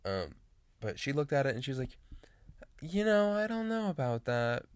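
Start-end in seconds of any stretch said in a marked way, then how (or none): 2.8-4.6 high, slow, skeptical hesitation; imitating a polite but unconvinced neighbor; lightly voiced neighbor, much higher and drawn out; doubtful old neighbor. Neighbor Joan disapproving of hair.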